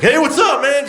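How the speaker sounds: high, loud passing greeting; shouted greeting. Retold or imitated. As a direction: call out cheerfully as you whiz by. Subway driver greeting in passing.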